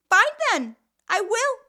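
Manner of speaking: loud, high, final retort; mother snapping back at her daughter, high and loud; angry snap back. Acted.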